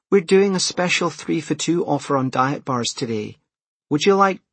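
high-pitched checkout sales patter; shop assistant. A narrator's reading. Cashier pitching an offer to hurried customer, high patter.

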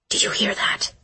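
hushed tense whisper. Acted. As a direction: whisper it under your breath. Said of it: "she whispered".

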